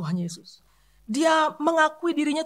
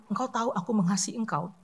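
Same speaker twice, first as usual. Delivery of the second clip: humble quiet Peter; 6 semitones lower, 7 dB quieter than usual. Retold or imitated. Preacher voicing Peter's humble reply to Jesus, soft and ashamed. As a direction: say it low and ashamed, barely above a breath. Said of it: "Petrus mengatakan".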